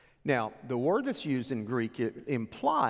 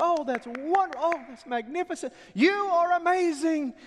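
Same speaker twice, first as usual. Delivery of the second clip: gushing crowd praise; 6 dB louder than usual. Acted. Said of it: "all the residents of Tyre say".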